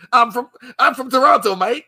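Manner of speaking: loud, high mock Aussie twang; mock Australian accent. Friend mocking Wolverine's accent, in mock Australian. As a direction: ham up an Australian accent, laughing. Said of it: "Why are you talking like that?".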